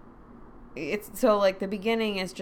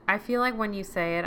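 usual speaking voice

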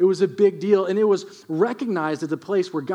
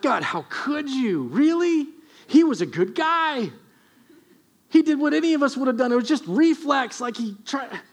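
higher, frantic disbelief at God; panicked protest; 7 semitones higher than usual. Acted. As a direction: plead in alarm, voice climbing.

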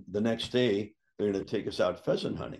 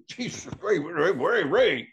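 exasperated plea; 8 semitones higher, 5 dB louder, slower than usual. Retold or imitated. Exasperated partner's voice in story.

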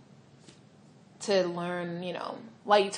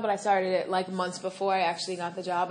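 normal talking voice

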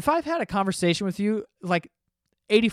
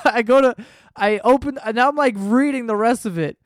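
laughing; 6 semitones higher, 7 dB louder than usual. Real, not acted. Laughing while retelling.

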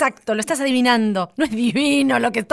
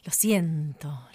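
whispering; 6 semitones lower, 5 dB quieter, much slower than usual. Acted. Whispered aside in a duet.

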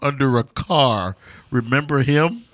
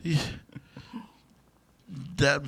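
ordinary delivery

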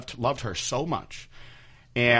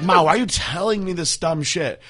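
raised, exasperated protest at mom; exasperated outburst; 8 semitones higher, 6 dB louder than usual. Retold or imitated. Telling his mom off, exasperated and loud. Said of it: "And I was like".

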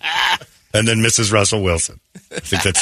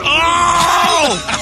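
long, very high "oh!"; shocked outcry; 4 dB louder than usual. Real, not acted. Host watching a medic fall on patient, high shocked howl. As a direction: cry out high at a painful fall.

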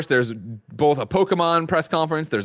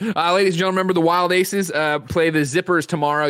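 upbeat announcer plug, a bit louder; 5 semitones higher, 3 dB louder than usual. Acted. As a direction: hype up tomorrow's stream. Show announcement read.

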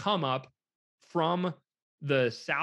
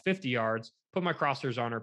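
normal talking voice

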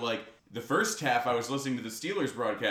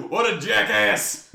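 laughing; 5 semitones higher, 6 dB louder, slower than usual. Real, not acted. Laughing after impression.

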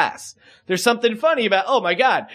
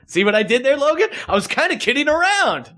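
raised voice, climbing much higher; real exasperated yell at a co-host, pitched up high; 7 semitones higher than usual. Real, not acted. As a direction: pitch it up as if yelling at your buddy. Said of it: "why I'm yelling at Logan".

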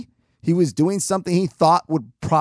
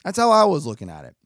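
ordinary delivery

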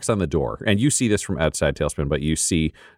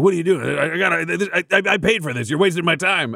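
high, indignant complaint; whiny doctor; 9 semitones higher than usual. Acted. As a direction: whine about being cheated. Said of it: "he's there being like".

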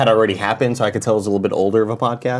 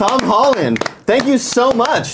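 host voice; 10 semitones higher, slower than usual. Pretend. Host greets guest in high voice.

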